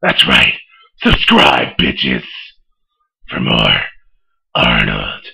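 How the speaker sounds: deep gravelly Batman growl; low, growling caped crusader. Acted. Holds the Batman voice.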